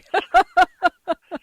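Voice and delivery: loud, high burst of laughter; real laugh, ringing out high; hearty laugh. Real, not acted. Host amused by the guest's accent attempt, loud high laughing.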